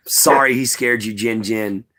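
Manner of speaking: ordinary talk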